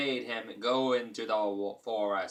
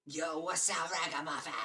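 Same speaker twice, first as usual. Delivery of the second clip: playful silly voice; 5 semitones higher, 5 dB quieter than usual. Pretend. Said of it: "gonna start calling people ragamuffins".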